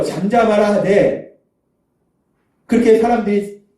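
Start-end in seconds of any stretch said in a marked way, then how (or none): none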